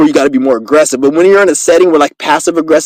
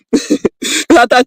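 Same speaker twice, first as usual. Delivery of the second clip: high, slow words through a laugh; 8 semitones higher, much slower than usual. Real, not acted. Explaining his mistake about English, laughing. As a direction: let the laugh take over the words. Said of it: "I started laughing".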